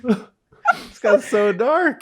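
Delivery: high, slow, laughing words. Real, not acted. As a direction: stretch it out through laughter. Repeated through laughter.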